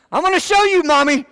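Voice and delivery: mock the kid back, playfully; teasing dad imitating the kids; mock threat. Father mocking kids' mommy calls.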